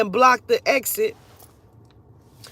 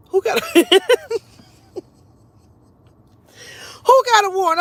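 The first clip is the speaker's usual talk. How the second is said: loud, much higher disbelief; incredulous protest; 10 semitones higher, 6 dB louder, faster than usual. Retold or imitated. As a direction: blurt it high, breaking into laughter. Shocked reply to warrant claim.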